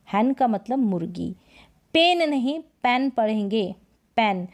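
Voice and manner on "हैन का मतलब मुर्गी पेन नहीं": usual speaking voice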